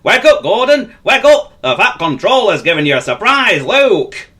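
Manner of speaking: bursting with news, wake him up; excited. Acted. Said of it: "feeling rather excited".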